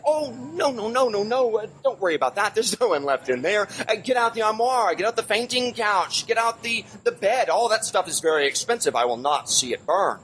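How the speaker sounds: high, fluttery society lady brushing it off; the Madame. Acted. Madame telling firemen to save her furniture, high dismissive voice. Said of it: "And the Madame said".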